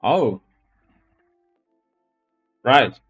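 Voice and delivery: ordinary talk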